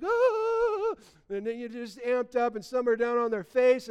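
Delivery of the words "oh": a high, loud imitated cry of rapture; hammed-up worshipper's cry; mock ecstatic cry. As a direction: wail it out like a worshipper carried away. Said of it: "other people are just like".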